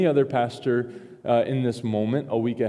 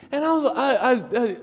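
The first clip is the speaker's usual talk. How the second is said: high, laughing stammer; sheepish laughing stutter; flustered laugh; 11 semitones higher than usual. Real, not acted. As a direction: caught out, laugh through the stammer. Flustered stammer at wife's question.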